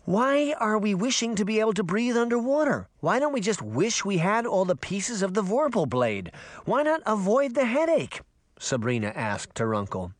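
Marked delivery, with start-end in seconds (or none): 0.0-8.2 put-on high, insistent girl's voice; pitched-up, impatient kid; questioning girl. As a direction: press uncle with exasperated questions.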